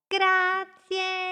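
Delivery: little boat squeals with joy; high, excited thanks. Acted. Little boat Smolly thanks Doroteo, excited and high.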